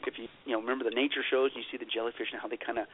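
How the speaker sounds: normal talking voice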